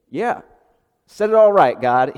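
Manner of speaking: mock cheer. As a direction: cheer God on at full volume. Mock version of Zechariah's cry.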